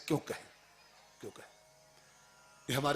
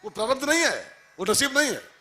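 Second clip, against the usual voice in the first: higher and louder, hammering the point home; forceful preaching; 8 semitones higher, 5 dB louder than usual. Real, not acted. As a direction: preach it out hard against the habit. Preacher scolding gutkha eaters. (ज़ोरदार प्रवचन)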